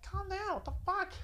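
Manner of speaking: imitating her shocked scolding; girlfriend voice.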